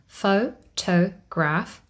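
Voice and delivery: Photograph broken slowly into pho, to, graph; "Photograph" spelt out in three slow, separate beats; syllable by syllable. Shown as a demonstration. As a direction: say "Photograph" at half speed, each syllable apart. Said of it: "we have three syllables".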